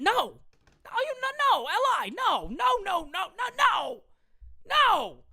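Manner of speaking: rising shouted string of no's; emphatic refusal. Real, not acted. Host refusing a viewer's super chat, shouting no. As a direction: shout him down, no after no.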